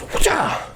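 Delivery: high martial effort yelp; mock athlete's cry on the throw; imitated throwing cry. Imitating Jeffrey hurling the cat like sport, effort yelp.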